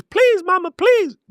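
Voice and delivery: high-pitched pleading, hammed up; mock begging. Platforms begging creators to go live, mock pleading. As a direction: plead like a kid begging mama.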